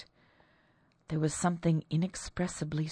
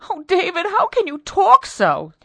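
high, loud reproach; horrified at his harshness; distressed wife; 14 semitones higher, 10 dB louder than usual. Acted. Wife protests husband's roughness.

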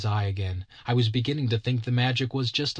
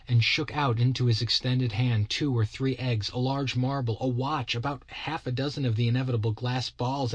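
usual speaking voice

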